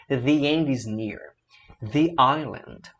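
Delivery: "The end" slow, thee with a Y glide; thee end slowly. Shown as a demonstration. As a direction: read "the end is near" with thee. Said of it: "Vamos ler comigo".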